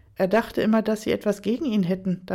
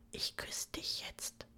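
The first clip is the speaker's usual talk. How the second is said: soft, slow whisper close up; 18 dB quieter, slower than usual. Acted. Daniel whispers to Aaron before the kiss, slow whisper. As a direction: breathe it as a whisper, slowly. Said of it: "flüsterte Daniel".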